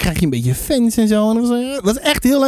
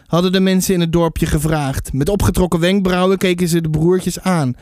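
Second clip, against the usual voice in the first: lower-pitched storybook reading voice; story narration; 5 semitones lower than usual. A narrator's reading. Author reads a little piece of the book to listeners, story narration. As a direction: read the chapter out as a storyteller.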